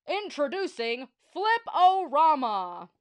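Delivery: slow, loud grand announcement; announcer voice. Acted. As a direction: announce it like a showman.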